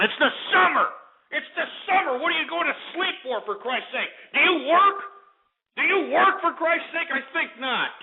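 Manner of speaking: high-pitched, indignant rant; angry outburst. Real, not acted. Host scolding caller who slept through the show, indignant outburst.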